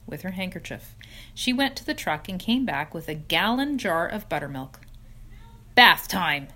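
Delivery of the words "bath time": cheerful. Acted. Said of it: "said Mister Zuckerman cheerfully".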